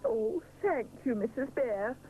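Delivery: truly touched; grateful. A narrator's reading. Mister Sponge thanking Missus Bear, grateful. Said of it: "says Mister Sponge gratefully".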